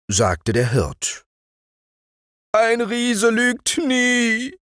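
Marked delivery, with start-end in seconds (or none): 2.5-4.6 very high, slow, drawn-out promise; giant's solemn vow. A narrator's reading. Giant assures the shepherd, slow high oath. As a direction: stretch it out like a wounded giant swearing.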